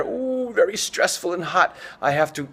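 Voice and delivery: high, squeezed "ooh" of mock stress; mock overheated strain. Teacher playing a steam engine building pressure, mock strain. Acting out overheating under the collar.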